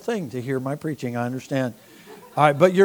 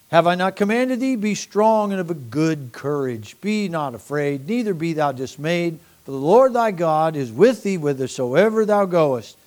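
a touch louder, measured scripture reading; 5 dB louder than usual. Acted. Preacher reading Joshua to the congregation, proclaiming. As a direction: read the verses out to the congregation. Reads Joshua aloud, rising.

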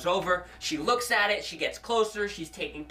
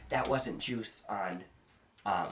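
hushed reveal; 11 semitones lower, 6 dB quieter than usual. Retold or imitated. Sister reveals the grim truth.

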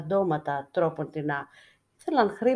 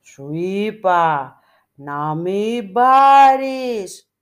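slow, booming divine voice, much louder; thunderous voice; 11 dB louder, much slower than usual. A narrator's reading. The icon of Christ forbids the beggar, booming. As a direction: boom each word down from above like thunder. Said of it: "και πάλι βροντερή φωνή ακούστηκε".

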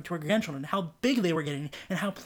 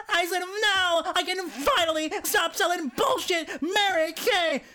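put-on young woman repeating; 11 semitones higher, slower than usual. A narrator's reading.

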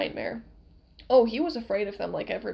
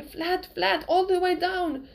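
urgent cry; 8 semitones higher, slower than usual. A narrator's reading.

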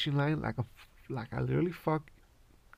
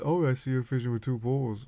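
mock officer; 3 dB louder than usual. Officer quoted checking poles.

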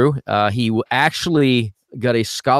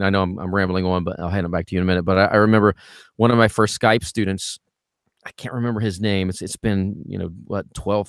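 normal talking voice

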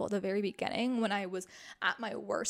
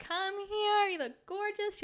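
much higher, imitating gushing boyfriend; adoring boyfriend; 10 semitones higher, slower than usual. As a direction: call her over, delighted. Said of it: "He was like".

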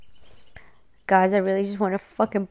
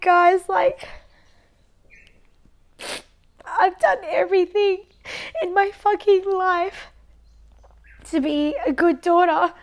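high, tearful and louder, voice breaking; real crying; 7 semitones higher, 4 dB louder than usual. Real, not acted. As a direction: push through the sobs to her listeners. Crying about being good daughter.

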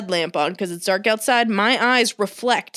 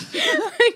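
high-pitched burst of laughter; 14 semitones higher than usual. Real, not acted. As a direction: break into a squealing laugh. Laughing at own story.

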